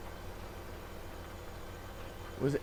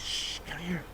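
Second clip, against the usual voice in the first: much softer, hushed beckoning; hushed drunk; 3 semitones higher, 8 dB quieter, slower than usual. Acted. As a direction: lean in and hush.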